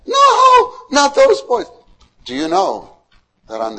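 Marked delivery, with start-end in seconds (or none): 0.1-1.7 much higher, louder hammed-up yell; mock-outraged shout. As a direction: protest in comic horror. Mother's outraged refusal.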